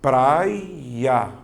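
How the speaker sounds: "praia" stretched as prai... ya; slow syllable-by-syllable "praia"; split into syllables. Shown as a demonstration. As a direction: sound out "praia" in two long syllables, PRAI-a. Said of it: "Você vai fazer, ó".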